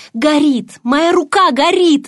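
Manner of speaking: high, slow cry of pain. A narrator's reading. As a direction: yell that something burns. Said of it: "закричала я".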